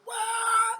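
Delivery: a high, loud mock baby wail. Deceived man bawling, hammed-up high wail. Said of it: "Then he gets to".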